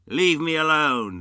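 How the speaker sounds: loud dismissive snap; irritable Scrooge. Acted. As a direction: wave him off. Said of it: "said Scrooge".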